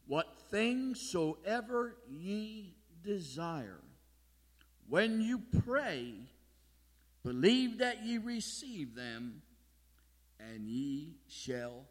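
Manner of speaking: slow, emphatic Bible reading with long pauses; scripture reading. Acted. Preacher reading Mark eleven to the congregation, slow solemn proclamation. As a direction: proclaim the verse phrase by phrase, slowly.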